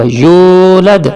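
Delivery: یولد with its واو held long; stretched vowel. Shown as a demonstration. Teacher showing how to stretch the واو. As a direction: draw out یولد slowly. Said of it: "کھینچ کے پڑھا جائے گا".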